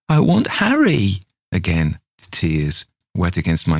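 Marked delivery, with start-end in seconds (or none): 0.1-1.2 put-on high child voice, stubborn; a high wail of a wanting child; tearful little girl. Little girl clings to Harry, high tearful child.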